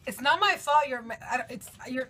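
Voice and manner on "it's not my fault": louder protest against mom; defensive protest. Real, not acted. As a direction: insist it wasn't you. Defends herself in a fight.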